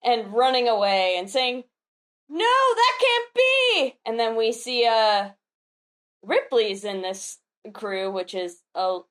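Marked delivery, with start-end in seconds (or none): 2.3-4.0 high, drawn-out cry of disbelief; acted yelling. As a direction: yell in theatrical denial while fleeing. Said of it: "they continue yelling".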